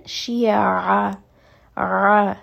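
Shi'a with the ain tight from the middle of the throat; throaty ain. Shown as a demonstration. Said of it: "you can hear that it's coming from the middle of the throat". (ain from the throat)

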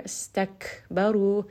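slow recited Istakbaru, audible exhale on the letters; Quranic recitation. Shown as a demonstration. Teacher demonstrating a Quran word to students, slow and precise. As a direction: recite Istakbaru slowly, breathing out on each letter. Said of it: "that little exhale is going to make that letter more audible".

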